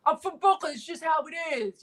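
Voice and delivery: mock Brooklyn guy, pitched well up; high-pitched send-up of a Brooklyn excuse. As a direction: shrug it off as an imitated Brooklyn guy. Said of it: "The Brooklyn guys".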